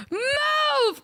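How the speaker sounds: high, stretched-out mock yell; long, high holler; imitated yell. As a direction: mimic a fan yelling from behind. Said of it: "the girls behind us yelled at him".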